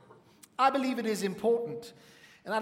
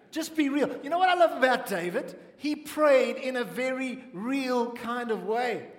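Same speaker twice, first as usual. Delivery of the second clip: excited preaching; 5 semitones higher, 3 dB louder than usual. Real, not acted. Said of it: "preacher urging realness".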